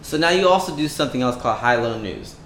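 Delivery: normal talking voice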